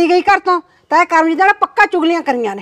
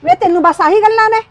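higher, fast, exasperated comeback; angry retort; 7 semitones higher, 4 dB louder, faster than usual. Acted. Wife snaps back at nagging husband, high and fast.

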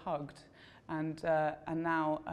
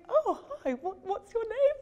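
very high, louder mock-polite voice; startled polite woman; 6 dB louder than usual. Julia caught in a hug, a high startled polite voice. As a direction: squeak it out like a startled, polite host.

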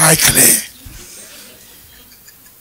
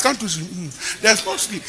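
ordinary talk, nothing marked

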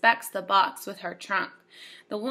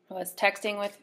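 usual speaking voice

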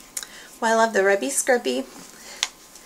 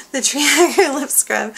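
higher, louder, with a laugh in it; laughing through words; 5 semitones higher, 6 dB louder than usual. Real, not acted. Vlogger recovering from a stumble on the product name, laughing.

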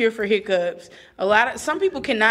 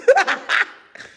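high cackling laugh; 12 semitones higher, 4 dB louder than usual. Real, not acted. Laughs at own threat.

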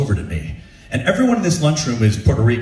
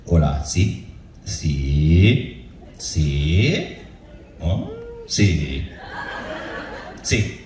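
low, drawn-out phone answers; imitating an unhurried director, long pauses between words; slow calm director; 7 semitones lower, 4 dB quieter, much slower than usual. Director takes a phone call, slow and calm. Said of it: "this director was speaking was so slow".

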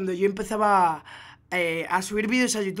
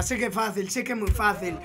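normal talking voice

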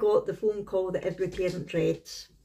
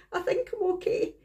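much higher, small shaky-sounding reply; imitating tearful daughter; 12 semitones higher than usual. Mother voicing her shaken daughter on the phone, high small voice. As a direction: voice the daughter's frightened little answer. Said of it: "really hysterical".

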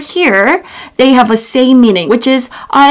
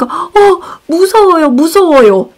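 mock scared; 5 semitones higher, much faster than usual. Shown as a demonstration. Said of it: "And then, like".

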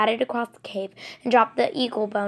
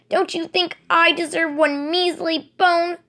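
snarl; 7 semitones higher, 3 dB louder than usual. Acted. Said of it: "she snarled".

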